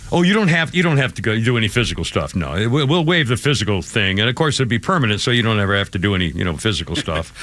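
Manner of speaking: low, quick, offhand dismissive patter; mock accommodating. Radio host mimicking lax recruiters waiving the physical stuff, mock accommodating. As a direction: rattle it off low, as a sarcastic waiver.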